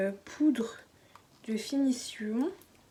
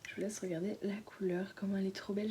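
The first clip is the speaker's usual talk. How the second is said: soft admiring whisper; 6 dB quieter than usual. Real, not acted. Baby sleeping nearby, whispering.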